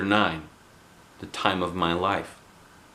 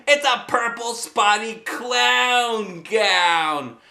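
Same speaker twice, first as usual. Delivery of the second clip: higher, slow mocking taunt; taunting boy; 12 semitones higher, much slower than usual. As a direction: drag out the insult. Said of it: "shouted Jim".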